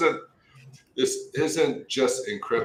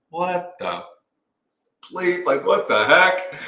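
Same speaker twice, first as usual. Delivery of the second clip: high-pitched, drawn-out retold outrage; acted incredulous protest, building louder; mock disbelief; 9 semitones higher, 7 dB louder, slower than usual. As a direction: stretch it out in exaggerated dismay. Retelling his disbelief at the scale.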